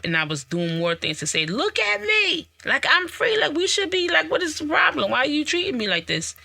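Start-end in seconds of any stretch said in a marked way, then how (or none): 1.5-6.3 pleading for attention. Retold or imitated. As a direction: beg them to look, pitched way up. Acting out plea to be noticed.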